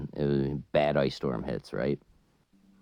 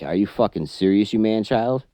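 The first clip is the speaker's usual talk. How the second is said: higher and louder, shouting at the driver; furious retold shout at the guy who threw the cigarettes; retold angry yell; 5 semitones higher, 6 dB louder than usual. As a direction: yell it in disbelief at a grown man.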